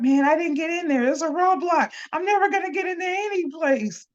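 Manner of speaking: high, fast defeatist whine; discouraged whine. Retold or imitated. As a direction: whine it like giving up. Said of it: "if I was like".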